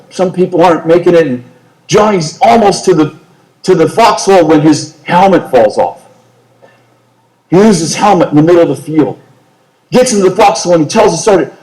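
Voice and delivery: ordinary delivery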